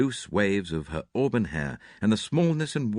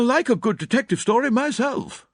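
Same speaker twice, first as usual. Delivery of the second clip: put-on high, brisk, blunt older lady; clipped spinster, sure of herself; Miss Howard; 6 dB louder than usual. A narrator's reading. Miss Howard voiced in reading.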